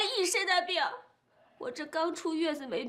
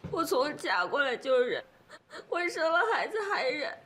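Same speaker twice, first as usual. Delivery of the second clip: sobbing complaint; 2 semitones lower than usual. Acted. Daughter cries to her mother about endless enduring, sobbing. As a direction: let the words break in sobs.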